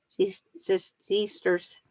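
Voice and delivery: softly trying out "sisters" as SEE-sters; own accent on sisters. Shown as a demonstration. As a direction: feel out the long ee in "sisters". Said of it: "There's a difference".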